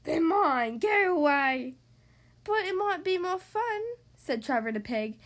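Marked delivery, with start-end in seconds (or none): 0.0-1.8 louder, grumbly pug voice; a greedy pug shooing him off; grumpy storybook pug; grumpy Pig. Acted. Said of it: "Pig would just grumble". 2.4-3.8 higher, pleading dog voice; gentle higher Trevor voice; hopeful Trevor. Acted.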